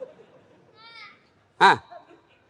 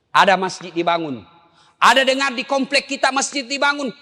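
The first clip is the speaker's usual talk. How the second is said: high, loud, urgent sermon call; preaching at full force; 13 semitones higher, 5 dB louder than usual. Acted. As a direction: rouse the congregation to give. Preacher urging charity. (ceramah berapi-api, suara tinggi dan keras)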